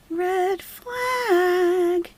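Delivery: mock alarmed squeak; high squeaky red flag. Mock alarm at chat comment.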